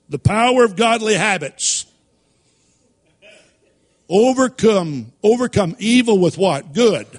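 preaching at full force; preaching emphasis. Real, not acted. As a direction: build to the congregation's answer. Preacher drives home sermon point.

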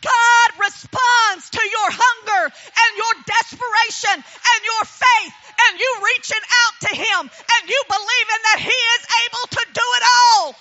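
loud, ringing preacher's voice; full force preaching. Acted. Testifying to the congregation about her faith, preaching loud. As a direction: preach it out to the back row.

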